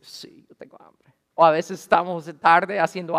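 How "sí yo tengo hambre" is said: whispered, hushed admission; half-whispered as the tired wife; sheepish whisper. Retold or imitated. Wife's sheepish answer, retold.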